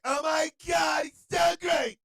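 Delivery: high, loud, slow mock swooning; mock fan screaming praise; gushing fan. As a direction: ham up an adoring follower. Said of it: "And you like".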